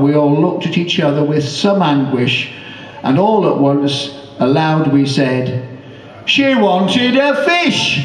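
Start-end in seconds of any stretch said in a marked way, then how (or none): 6.2-8.0 high, slow, disbelieving punchline; incredulous punchline. Acted. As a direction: stretch it out in amazement. Punchline said in unison.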